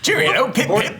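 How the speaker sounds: high-pitched, playful British caricature; mock British chirp. Player joins the host's silly voice, mock British chirp. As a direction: chirp it like a cartoon Brit.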